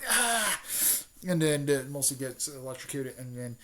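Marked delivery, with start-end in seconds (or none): 0.1-0.6 high cartoon cry of pain; mock electrocution yelp. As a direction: yelp like you got zapped. Character hit by electricity.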